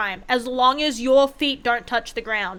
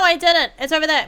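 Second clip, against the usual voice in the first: ordinary delivery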